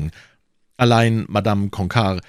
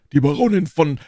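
loud, raised voice listing sacrifices; emphatic, higher character voice; boasting Porthos; 10 semitones higher, 6 dB louder than usual. A narrator's reading. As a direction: press on insistently until cut off. Porthos goes on, gets cut off.

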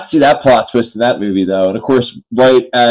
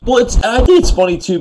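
voice cracking; 11 semitones higher than usual. Real, not acted. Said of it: "because of course my voice cracks".

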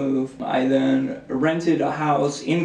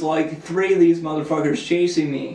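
ordinary talk

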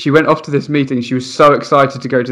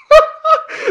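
shrieking laugh; high squeal of laughter. Real, not acted. Laughing at child's complaint.

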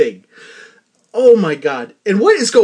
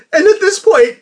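laughing; 11 semitones higher, 5 dB louder than usual. Real, not acted. Still laughing.

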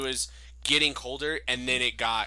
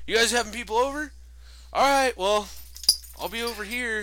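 higher-pitched imitation of an eager neighbor; mock fishing for an invitation; hinting neighbor; 9 semitones higher than usual. Neighbor angling to join the party, mock hopeful. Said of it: "he's always like".

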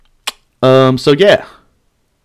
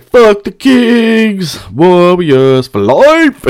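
long, high, drawn-out yell; retold screaming; 8 semitones higher, 4 dB louder, much slower than usual. Kicked-out fan outside arena screaming team loyalty, drawn-out scream. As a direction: stretch every word into a rowdy fan holler. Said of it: "I was screaming shit like".

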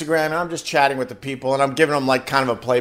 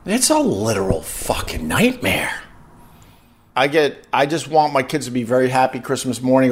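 ordinary talk, nothing marked

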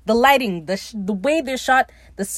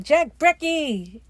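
calling out; 7 semitones higher, much slower than usual. Retold or imitated. Ed calling Jack to breakfast, slow cheerful call. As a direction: call him to the table, drawing it out.

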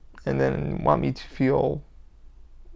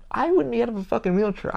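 usual speaking voice

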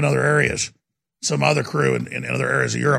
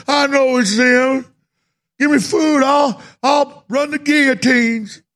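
much higher, slow, half-shouted whine; mock desperate citizen; 9 semitones higher, slower than usual. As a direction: ham up a starving man turning angry. Mocking a vaccinated citizen.

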